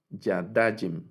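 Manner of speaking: "Yadajim" slowly, accent on the middle syllable "da"; penultimate stress. Shown as a demonstration. Teacher giving the correct pronunciation, "Yadajim" stressed on the penultimate "da". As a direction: stress "da" in "Yadajim" and let it fall. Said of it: "la pronunciación".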